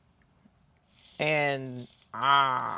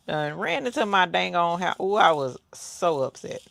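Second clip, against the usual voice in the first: usual speaking voice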